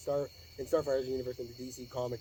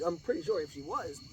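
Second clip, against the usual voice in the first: normal talking voice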